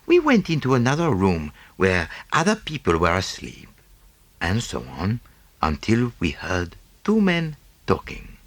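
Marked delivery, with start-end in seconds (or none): none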